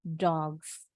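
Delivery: "dogs" said low and soft, ending in a buzzing z; s sounded as z. Shown as a demonstration. Teacher repeats "dogs" with the z ending. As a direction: end "dogs" on a clear z, not an s. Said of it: "Huruf S-nya bunyinya kayak Z".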